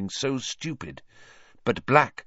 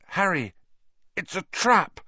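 high, strained warning; hurting and urgent; pained warning; 8 semitones higher than usual. A narrator's reading. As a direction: warn him through gritted teeth. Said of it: "His teeth were gritted with pain".

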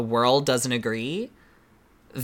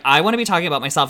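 quick, louder mock whine about herself; mock self-centred whine; 4 semitones higher, 6 dB louder, much faster than usual. Janie cutting in during Untucked, fast mock whine. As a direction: rattle it off like a queen desperate for attention. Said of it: "And Janie's like".

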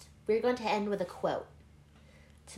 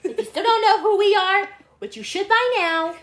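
loud; 11 semitones higher, 8 dB louder than usual. Real, not acted. Loud sign-off.

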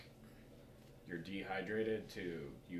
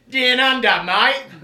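very high and loud cartoon Australian; hammed-up Aussie shout; Australian accent; 22 dB louder, much slower than usual. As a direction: belt "Down under, mate" in an overblown Aussie accent. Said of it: "story in an Australian accent".

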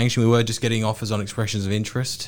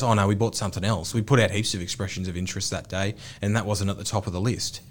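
flaky buyer; 6 semitones lower, 5 dB quieter than usual. Retold or imitated. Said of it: "that buyer goes".

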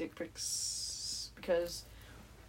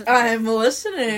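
eager I'm listening; 3 semitones higher, 16 dB louder, slower than usual. Retold or imitated. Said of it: "and I was like".

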